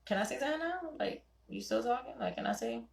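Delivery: hushed wife asking to speak; soft asking. Retold or imitated. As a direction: ask permission gently. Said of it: "I would be like".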